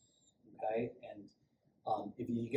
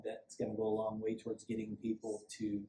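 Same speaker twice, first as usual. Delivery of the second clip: normal talking voice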